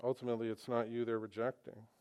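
ordinary talk

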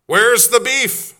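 high, loud, drawn-out slogan; ad catchphrase shout. Acted. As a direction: holler the old commercial line. Performs famous ad slogan for quiz.

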